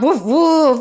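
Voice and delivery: woofing out a dog imitation; dog woofs. Shown as a demonstration. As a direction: woof like a dog for a toddler. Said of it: "what sound does a dog make".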